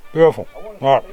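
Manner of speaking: talking through a mouthful; said while chewing a bite; mouth full. Real, not acted. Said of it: "Shouldn't talk my mouth full".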